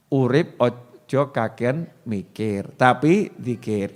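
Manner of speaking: low, soft and slow, each word weighed; quiet, measured saying of "Urip ojo kakean mikir"; slow recited motto. Shown as a demonstration. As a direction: let the motto sink in slowly. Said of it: "slow recited motto".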